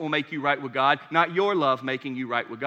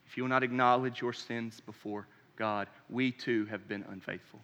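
quiet solemn plea; 5 semitones lower, 6 dB quieter than usual. Acted. Solemn call to confess sins.

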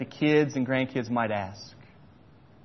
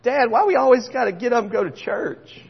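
put-on higher, complaining child; lightly voiced kid whining; whiny kid; 8 semitones higher, 3 dB louder than usual. Kid whining to dad about church, higher pitch.

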